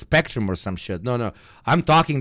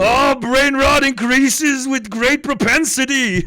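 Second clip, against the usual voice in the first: mock pompous voice; 13 semitones higher, 6 dB louder, slower than usual.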